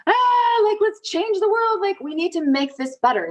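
imitating shouting it from the balconies; mock rally cry. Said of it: "screaming from the balconies".

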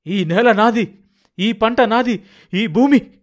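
high, loud cry staking a claim; anguished full-voiced cry. Old farmer claiming his land and crop, anguished shout. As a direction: shout it out to the skies. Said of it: "అతని కంఠస్వరం, విడిచిన గాలి విశ్వవ్యాపితమైంది".

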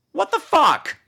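high, shocked "What the fuck?"; shocked disbelief. Pretend. Host reacting to her diagnosis, high shocked squeal. Second take, outraged.

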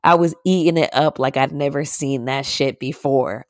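ordinary talk, nothing marked

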